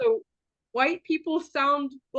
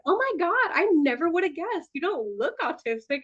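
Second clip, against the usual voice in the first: mock gushing surprise; 8 semitones higher than usual. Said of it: "the amount of times I've heard".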